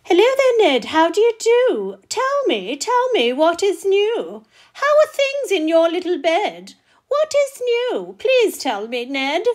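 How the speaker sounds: high, loud, bouncy creature voice; excited greeter. Acted. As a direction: pester Ned eagerly for news. Creature greets Ned in rhyme.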